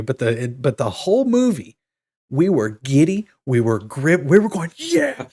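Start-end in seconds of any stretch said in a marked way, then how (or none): 4.8-5.3 high whooping yeah; a quick high cheer of joy; excited cheer. Retold or imitated. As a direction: whoop like a fan in the theater. Cheering at the screen.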